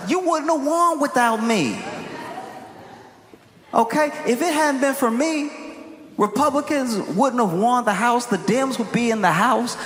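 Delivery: high-pitched indignant outburst; imitating someone madder by the minute; mock angry rant. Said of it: "He's getting madder and mad".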